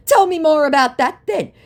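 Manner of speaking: very high, loud put-on voice; mock eagerness. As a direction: pipe up as the prying scammer. Acts out scammer's probing reply.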